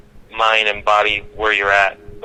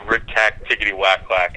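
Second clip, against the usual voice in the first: usual speaking voice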